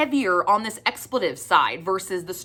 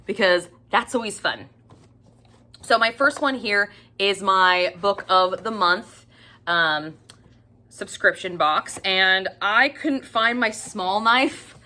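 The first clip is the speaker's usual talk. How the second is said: ordinary delivery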